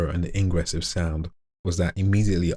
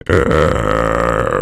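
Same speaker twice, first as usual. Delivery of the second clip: long, low, loud creak; very slow inward growl; 7 semitones lower, 6 dB louder than usual. Shown as a demonstration. Tampering with the slowed sound, creepy long growl. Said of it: "really slow it down".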